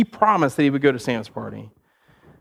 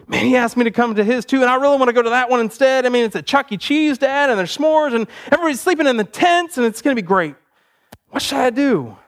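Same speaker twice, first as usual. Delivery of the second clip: higher-pitched, excited appeal; eager pleading kid; 7 semitones higher than usual. Acted. Boy asking his dad about rival party, eager pleading. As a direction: plead your case to Dad, carried away.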